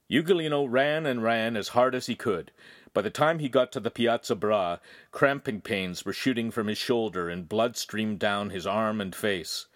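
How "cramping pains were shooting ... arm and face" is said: usual speaking voice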